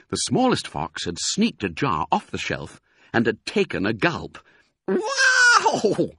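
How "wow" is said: very high, long "wow"; amazed gasp. A narrator's reading. Smallest fox gulps strong cider, gasping. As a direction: gasp it out, sliding on and on. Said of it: "he gasped".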